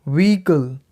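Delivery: व्हीकल as "VEE-kul", silent h; correct pronunciation. Shown as a demonstration. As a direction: say व्हीकल in two syllables, no h. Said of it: "इसकी सही pronunciation होगी". (व्हीकल को 'वीकल', बिना 'ह' के)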